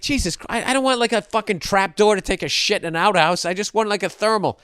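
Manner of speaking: high-pitched indignant protest; acted exasperation. Exasperated reply to clerk, acted.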